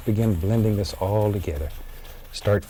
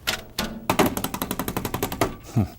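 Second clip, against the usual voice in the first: quiet chuckle under breath; soft breathy laugh; 3 dB quieter than usual. Real, not acted. Laugh while cleaning brush.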